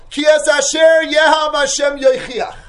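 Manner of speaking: loud, high proclaimed verse; fiery sermon chant; preaching the verse. Acted. Verse proclaimed at full force.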